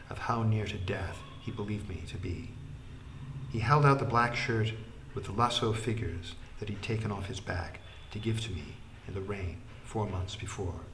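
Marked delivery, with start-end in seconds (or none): none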